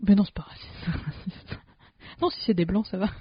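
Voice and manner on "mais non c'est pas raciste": whisper. Pretend. Whispered slow denial, laughing.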